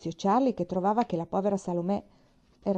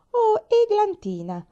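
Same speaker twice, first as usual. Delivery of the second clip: high, slow and louder, full of fire; an ardent, high-pitched cry of the name; fiery exclamation; 8 dB louder, slower than usual. A narrator's reading. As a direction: fling the name out with passion. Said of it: "esclamò con fuoco".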